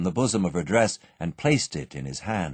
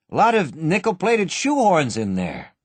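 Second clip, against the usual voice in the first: cool; 7 semitones higher, 3 dB louder than usual. A narrator's reading. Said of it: "said Jimmy coolly".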